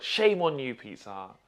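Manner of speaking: mock shaming, high and loud then dropping; tongue-in-cheek scolding. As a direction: playfully scold the company, punching the first words. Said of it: "I say that tongue in cheek".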